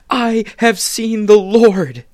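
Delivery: high, very loud and slow; Mary shouting her joy to the disciples; ecstatic announcement. Acted. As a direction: burst in shouting the good news.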